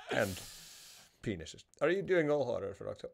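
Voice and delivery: normal talking voice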